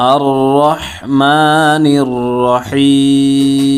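slow recitation, long "maa", very long "hiiim" in রহিম; measured Quran recitation with রহিম stretched out; three-alif stretch at stop. Shown as a demonstration. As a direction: hold the final "hee" of আর রহমানির রহিম before closing on m. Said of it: "তিন আলিফ টানতে হবে".

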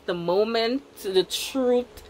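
usual speaking voice

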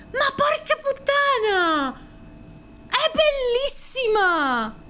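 high, slow gushing cries; high drawn-out delighted wail; thrilled. Real, not acted. Thrilled by a beautiful card.

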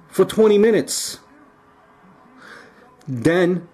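ordinary talk

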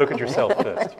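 a laughing punchline to the crowd. Real, not acted. S1 jokes about the telescope, laughing loudly.

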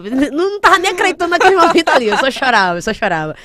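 contando entre risos, mais alto e agudo; rindo ao contar. Real, not acted. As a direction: ri da própria choradeira. Ri contando que só chorava. (fala animada entre risadas)

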